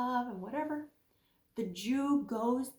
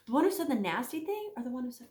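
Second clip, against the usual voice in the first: usual speaking voice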